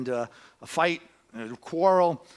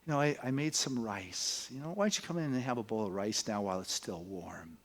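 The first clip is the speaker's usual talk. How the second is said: a gentle, hushed offer of rice; mother's quiet welcome to a daughter returning; mom speaking very softly; 5 semitones lower, 6 dB quieter than usual. A narrator's reading. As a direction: soften right down, like coaxing a child home. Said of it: "her mom says very softly".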